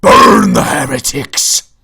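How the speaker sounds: slow, loud, rough-edged roar; mock zealot shout. Streamer calling to burn heretics, hammed-up roar. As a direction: bellow it like an overdone battle cry. Mock war cry in game.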